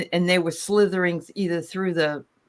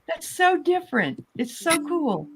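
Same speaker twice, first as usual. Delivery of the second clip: high, gushing delight; thrilled, high-pitched gush; 12 semitones higher than usual. Real, not acted. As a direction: squeal with real enthusiasm, easing slightly toward the end. Delighted reaction to a listener's story.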